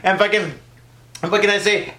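ordinary talk, nothing marked

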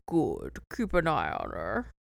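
low mock-booming overlord; deep booming voice. Booming voice answering the rock, mock deep. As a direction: drop it low for a pretend boom. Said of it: "a deep booming voice says".

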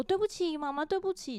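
put-on high little-kid voice saying sorry in a rush; a child's hurried apology; child apologizing. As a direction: play the small son, quick and contrite. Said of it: "他马上就会说".

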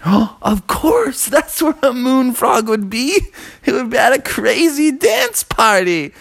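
high-pitched burst of sudden discovery; storybook hero's eureka moment; excited realization. Acted. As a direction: gasp it out high and thrilled. Frog has sudden excited realization.

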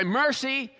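high, mock plea of "Mercy"; mock cry of mercy. Preacher acts out a kid in pain crying mercy. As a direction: play the kid crying uncle, pitch up. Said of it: "And then when they cry".

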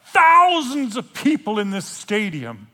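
ordinary delivery

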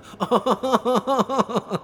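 loud, high evil laugh; sinister chuckle. Acted. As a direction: cackle like a villain who's finally got it. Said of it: "That's pretty sinister".

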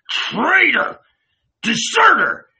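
high, full-throated accusing shout; savage shout. A narrator's reading. Judas accusing Jesus of desertion, savage high shout. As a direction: shout each word as an accusation.

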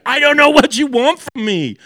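high, loud exasperated cry; imitating the wife's frustrated outburst. Wife snapping back at her husband in frustration, high and loud.

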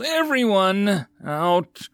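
high, drawn-out yell. A narrator's reading. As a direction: yell it to clear the tent. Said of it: "and yelled".

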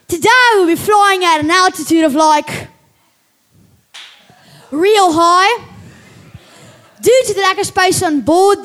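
loud, high Aussie captain on the intercom; Aussie pilot announcement. Acted. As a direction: boom it out like a cheeky outback pilot. Kid reads pilot announcement.

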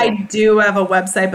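ordinary delivery

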